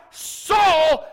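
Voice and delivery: very high, loud drawn call; commanding full-voice shout; hollering out. Shown as a demonstration. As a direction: holler the name with all authority. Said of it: "When he hollered out".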